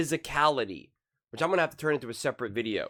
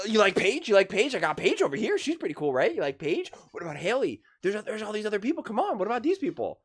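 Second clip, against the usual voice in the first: high, loud, wheedling pitch of other players; mock pushy salesman; 6 semitones higher, 5 dB louder than usual. Commentator playing the league pushing other players, high and wheedling. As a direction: desperately push the other players on the fan.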